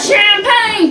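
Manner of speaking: high-pitched playful shout; celebratory shout. Pretend. Commentator celebrating the winners' champagne, high playful shout. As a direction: shout it high, hailing the spray.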